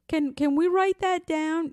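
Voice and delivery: high-pitched imitation of an anxious pupil; imitated parent. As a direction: pitch it up, asking like an elderly parent. Voices parent asking in retold exchange.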